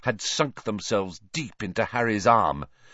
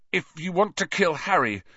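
higher, defiant and fierce; 7 semitones higher than usual. A narrator's reading. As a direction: stand up to him fiercely. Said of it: "he said fiercely".

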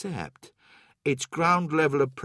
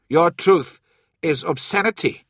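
loud, raised retort; indignant outburst; 5 semitones higher, 7 dB louder than usual. A narrator's reading. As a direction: spit back the accusation, loudly. Old man angrily rejects Loken's claim.

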